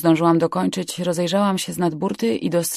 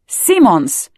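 loud, higher, falling summons; sharp call; 4 semitones higher, 7 dB louder than usual. Acted. Captain calling his man from upper deck, sharp shout. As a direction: call him out by surname.

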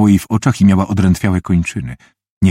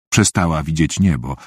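ordinary delivery, nothing marked

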